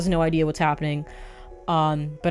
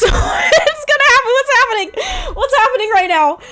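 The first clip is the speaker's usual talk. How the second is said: panicked laughter; 5 dB louder than usual. Real, not acted. Panicked laughing at scary moment.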